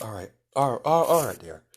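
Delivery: lightly voiced caring medic soothing a patient; soothing healer. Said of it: "He's like".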